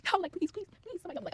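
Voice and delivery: high, loud mock begging; panicked plea. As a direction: act out pleading for rescue, pitch jumping up. Retelling her panic during fight.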